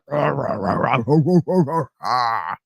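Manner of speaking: imitating a long, drawn-out Tim Allen grunt; a goofy sitcom grunt done from the throat. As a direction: ape the Home Improvement grunting noise. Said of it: "when he went".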